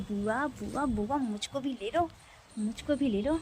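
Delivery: ordinary talk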